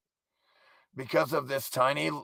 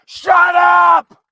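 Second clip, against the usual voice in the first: high, loud bark of shut up; furious shout; 13 dB louder than usual. Real, not acted. Man yelling at the bird interrupting him, high loud shout. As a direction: snap at the bird.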